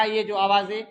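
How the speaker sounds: usual speaking voice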